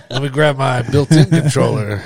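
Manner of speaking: laughing through the words. Acted. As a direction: joke it while laughing. Joking mock quote, laughing.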